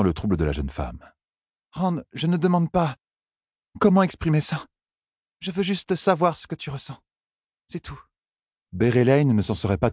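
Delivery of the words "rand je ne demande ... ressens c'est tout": high-pitched, insistent heroine; pleading young woman. Acted. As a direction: press gently for an answer in a high voice. Heroine presses for his feelings.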